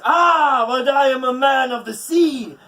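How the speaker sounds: high, loud proud sea-god voice; Njord crying out. A narrator's reading. Njord defending his feet, proud and loud. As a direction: boast it grandly. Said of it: "Njord cries".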